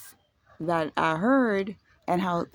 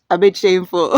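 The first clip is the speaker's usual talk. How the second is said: loud embarrassed laughing; real laugh, louder; 12 dB louder than usual. Real, not acted. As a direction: admit it through loud laughter.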